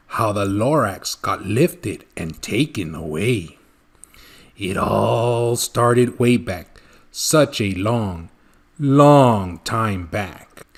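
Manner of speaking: slow, low storyteller drawl; slow, reminiscing old Once-ler; Once-ler telling his tale. Acted. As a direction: draw it out slowly, looking back in time.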